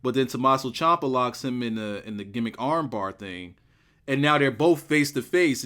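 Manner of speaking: usual speaking voice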